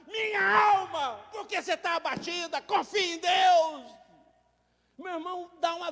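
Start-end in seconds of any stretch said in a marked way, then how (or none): 0.1-4.0 crying out to the soul. Acted. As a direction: cry out confia em Deus.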